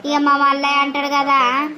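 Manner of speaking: usual speaking voice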